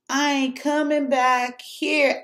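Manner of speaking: slow and firm, spelling out "I ain't coming back here"; slow emphatic vow. Real, not acted. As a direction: draw out each word of "I ain't coming back here" like a final verdict. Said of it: "repeated, slower vow".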